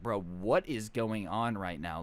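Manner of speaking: low, slow, drawn-out bafflement; mildly stunned, spacing the words out slowly; low incredulous bystander reaction; mock disbelief. Onlookers baffled by the fight.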